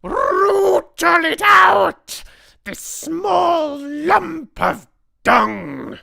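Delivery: very high, slow, full-force screech. A narrator's reading. Grand High Witch hunts the hidden intruder, furious screech. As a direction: screech it with every word drawn out. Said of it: "screeched the Grand High Witch".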